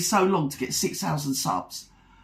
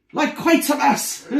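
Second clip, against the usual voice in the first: laughing; 6 semitones higher than usual. Real, not acted. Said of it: "laughs at own joke".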